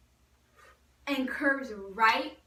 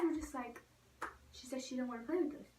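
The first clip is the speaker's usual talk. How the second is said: ordinary talk, nothing marked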